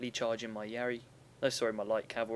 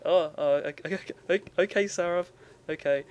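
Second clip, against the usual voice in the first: higher, louder hurried okays, caught out; mock flustered giving in, louder and higher; flustered agreement; 6 semitones higher, 8 dB louder than usual. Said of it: "And I was like".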